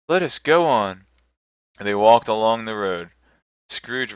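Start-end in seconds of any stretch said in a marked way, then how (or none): 0.1-1.1 lightly raised solemn spirit voice; ghost voice. A narrator's reading. Spirit answers Scrooge, lightly voiced ghost. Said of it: "observed the ghost".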